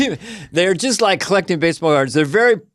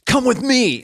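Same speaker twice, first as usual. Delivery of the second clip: higher, hushed urgency; producer urging; 8 semitones higher, slower than usual. Retold or imitated. Producer leading him away from the crowd, urgent mock call.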